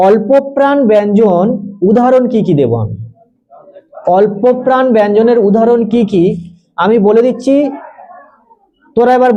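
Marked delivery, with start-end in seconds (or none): 0.1-1.4 slow dictation. Acted. Teacher dictating. 4.2-6.2 slow dictation. Acted. Teacher dictating.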